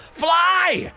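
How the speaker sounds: high urgent yell. Acted. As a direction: shout the order. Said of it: "he hears a voice yell out".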